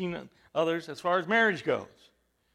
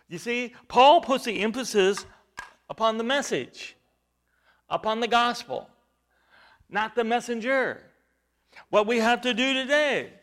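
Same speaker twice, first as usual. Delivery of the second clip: higher, emphatic preacher's cadence; preaching; 5 semitones higher, 5 dB louder than usual. Acted. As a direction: hammer each point to the church.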